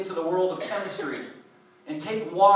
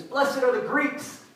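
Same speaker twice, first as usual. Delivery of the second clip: slow, higher "Blessed are the Greeks", relayed as a garbled message; misheard sermon line; 6 semitones higher, slower than usual. Retold or imitated. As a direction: pass on "Greeks" like a half-heard rumour. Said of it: "He goes".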